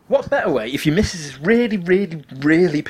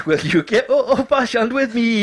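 playful put-on voice; 9 semitones higher, slower than usual. Playful sung-like opening line.

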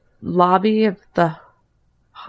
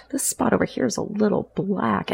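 ordinary talk, nothing marked

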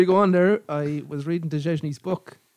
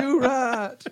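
mock outraged call; 8 semitones higher than usual. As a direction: imitate a far-away angry man, pitched up. Repeats angry man's distant yell.

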